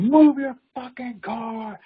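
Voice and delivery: slow, high, drawn-out mock scream; stretched-out furious yell, imitated; screaming at top of lungs. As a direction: imitate a lunatic bellowing each word. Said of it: "at the top of his lungs".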